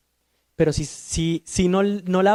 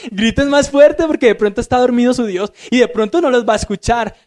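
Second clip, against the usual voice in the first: higher, louder, laughing taunt; mocking Elijah; 6 semitones higher, 5 dB louder than usual. Elijah taunting the prophets of Baal, loud and laughing. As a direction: jeer at them through laughter. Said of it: "se empezó a burlar de ellos".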